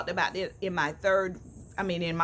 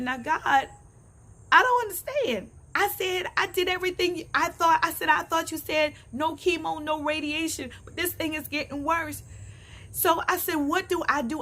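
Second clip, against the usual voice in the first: pleading prayer; 7 semitones higher than usual. Retold or imitated. Retold desperate prayer to God.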